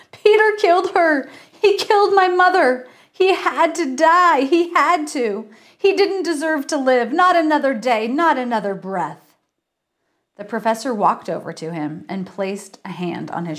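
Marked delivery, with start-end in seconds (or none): 0.1-9.3 high, lightly sobbing outburst that fades; a hint of sobbing grief, pleading; sobbing rant. Grieving son blurts out his crime, lightly sobbing. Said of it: "He sobbed".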